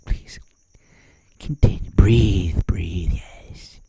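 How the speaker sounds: hushed, coaxing whisper; mock soothing whisper. Media nursing the president along, soothing mock whisper. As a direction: whisper him through his breathing.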